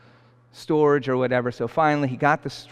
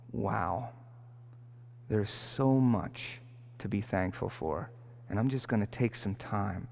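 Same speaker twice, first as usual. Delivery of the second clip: quiet gratitude; 8 dB quieter than usual. Retold or imitated. Imagined grateful inner thoughts.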